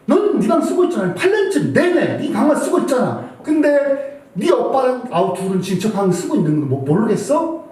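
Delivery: high, pressing scolding of the daughter; retold angry scolding. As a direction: hammer the point at her angrily. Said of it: "나는 화를 팍팍 냈어요".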